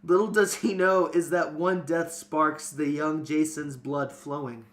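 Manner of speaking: ordinary talk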